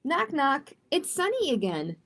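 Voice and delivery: plain reading voice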